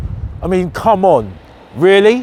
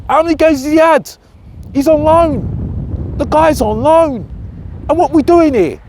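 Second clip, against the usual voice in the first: incredulous, much higher voice; exasperated high-pitched rant; 10 semitones higher than usual. Retold or imitated. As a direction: sputter it out in disbelief. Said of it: "And I was like".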